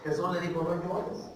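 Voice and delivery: low, very soft quoted comfort; quiet, consoling retelling; hushed answer. Retold or imitated. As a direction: drop right down to quote gently. Quiet answer to the mother's protest.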